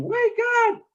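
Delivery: high piercing scream; screaming alarm. Acted. Mud hen screaming at the ducks to wake up, high. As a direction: scream the warning. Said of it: "just screaming".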